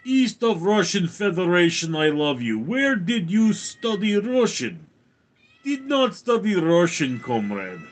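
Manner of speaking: put-on Russian accent. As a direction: talk like a Russian comrade. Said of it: "Simply talk like a Russian".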